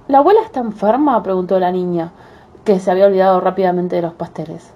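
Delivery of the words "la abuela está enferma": put-on little girl voice. Girl voiced in fairy tale.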